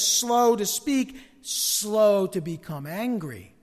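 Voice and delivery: each word drawn out very slowly; preacher stressing the verse slowly; slow, deliberate emphasis. Real, not acted. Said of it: "quoting James, drawn out".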